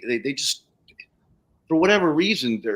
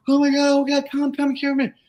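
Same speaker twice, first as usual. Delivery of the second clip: retold screaming. Jeannie calling her neighbor over, high mock scream. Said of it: "she starts screaming like".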